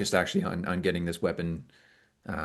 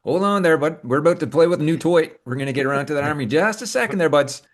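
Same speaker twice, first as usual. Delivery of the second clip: higher, louder in-character brush-off; [name]'s voice; 8 semitones higher, 6 dB louder than usual. Acted. Character puts off the buds to play with a toy, high and loud. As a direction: pitch it up and push back at the buddy.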